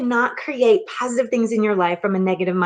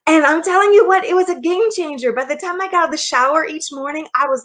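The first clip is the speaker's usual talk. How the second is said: pushing through tears while telling it; fighting tears; 6 semitones higher than usual. Real, not acted. Telling viewers about her shower prayer, near tears. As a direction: voice pitched up, close to crying. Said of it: "I'm gonna try not to cry".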